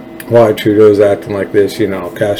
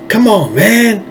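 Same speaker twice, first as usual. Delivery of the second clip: incredulous; 12 semitones higher, slower than usual. Real, not acted. Incredulous outburst.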